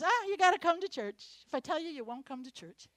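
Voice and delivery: loud start then quick playful Bishop impression; imitating Bishop. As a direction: tease the congregation as Bishop does. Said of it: "Bishop says".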